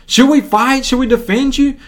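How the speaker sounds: high-pitched eager questions; urgent asking. Acted. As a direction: act out disciples itching to fight. Disciples urgently ask Jesus.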